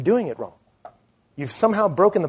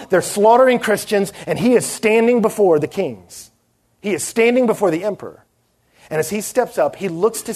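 building preaching intensity; 5 semitones higher than usual. Acted. A preacher painting Paul before the emperor, building fervor. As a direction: preach it, rising to a fervent peak.

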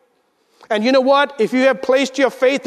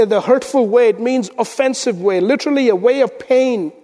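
ordinary talk

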